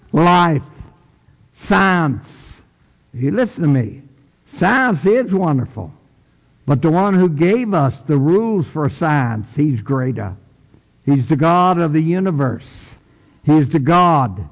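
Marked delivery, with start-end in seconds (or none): none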